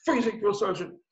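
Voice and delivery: high, put-on recruit voice; freezing recruit. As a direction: report up to the sergeant, acting the scared recruit. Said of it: "So I was like".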